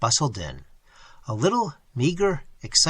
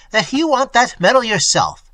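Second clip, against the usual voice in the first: loud, higher accusing; shouting accusation; 7 semitones higher, 6 dB louder than usual. A narrator's reading. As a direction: hurl the charge at him. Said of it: "shouted Quint".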